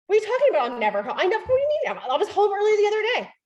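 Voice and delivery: high-pitched indignant protest; defensive. Acted. Accused partner defending themselves, defensive. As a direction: act out an indignant, rushed self-defence. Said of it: "Defend themselves".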